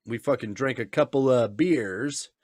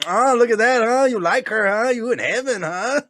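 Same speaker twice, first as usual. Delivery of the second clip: high, louder teasing; imitating Marco teasing; 9 semitones higher, 5 dB louder than usual. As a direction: egg him on like a grinning buddy. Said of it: "he'll be like".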